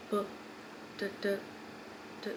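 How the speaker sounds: very soft, spaced-out thuds; quiet beats with long gaps between; slow heartbeat imitation. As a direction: imitate a heart slowing to a stop. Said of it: "it was going real slow".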